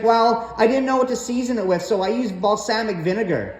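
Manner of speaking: high, quick, innocent mom voice; mom's voice. Retold or imitated. Mom explains the soup to her son, high and quick. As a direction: chirp it out fast like an unbothered mom.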